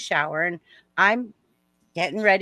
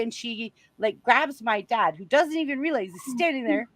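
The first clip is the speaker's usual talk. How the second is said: usual speaking voice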